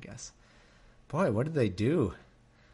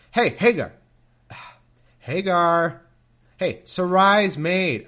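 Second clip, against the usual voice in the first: higher, louder mock call; 7 semitones higher, 9 dB louder, slower than usual. Angel calling Hagar in the wilderness, mock loud call. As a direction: call across to her, teasing.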